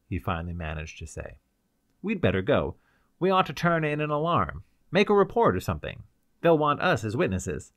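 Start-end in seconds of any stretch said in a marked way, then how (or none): 2.0-7.7 louder, higher, pressing to leave; urgent. Acted. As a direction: hurry her along, he's shaken. Urging they report the deaths.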